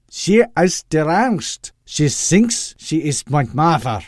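put-on high, loud, slow thick accent; defensive, heavy put-on accent; thick Doctor Loeb accent. A narrator's reading. Son insisting his mother is deranged, defensive accent. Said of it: "his Doctor Loeb performance".

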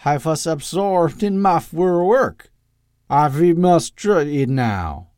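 put-on slow, loud, heavy foreign accent; thick Doctor Loeb accent. A narrator's reading. Doctor pleading with his mother, thick accent. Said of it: "his Doctor Loeb performance".

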